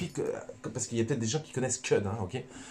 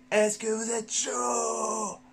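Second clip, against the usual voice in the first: drawn-out shouted hype question, much higher; 13 semitones higher, much slower than usual. Acted. Host fires up his live viewers, shouting slowly and much higher. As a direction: fire up the crowd like a stadium host, slow and high.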